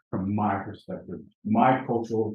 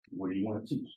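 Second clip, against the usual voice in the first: repeated probing question; 4 semitones lower, 10 dB quieter, faster than usual. Retold or imitated. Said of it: "My second question".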